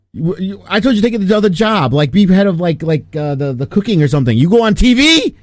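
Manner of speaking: high, loud mock tirade; Casino mobster impression. Acting out a Casino scolding for co-host, exasperated. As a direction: build to an exasperated, high-pitched yell. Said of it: "Where he's like".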